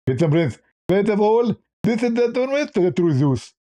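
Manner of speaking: high lisping mock debater; Zakir Naik. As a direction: object in a put-on, lisped high voice. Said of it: "Even Zakir Naik, if we call him".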